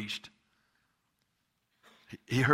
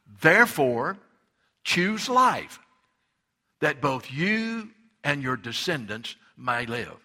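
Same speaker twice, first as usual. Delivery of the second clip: preaching at full force; 4 dB louder, slower than usual. Acted. Preacher urging the congregation to choose life, fervent. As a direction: preach it like a call to decide.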